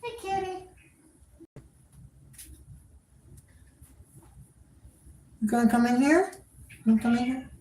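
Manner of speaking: high, soft pet-talk voice. Real, not acted. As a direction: coax the cat sweetly. Talking to the cat.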